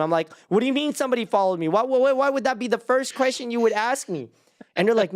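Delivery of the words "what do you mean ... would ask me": pepper them with panicked questions; mock indignant alarm. Retold demanding question to aunt.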